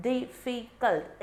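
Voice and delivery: "difficult" split slowly into three beats; syllable by syllable. Shown as a demonstration. Teacher shows students the syllable count, "difficult" broken up slowly. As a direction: sound out "difficult" one syllable at a time. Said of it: "is three syllables".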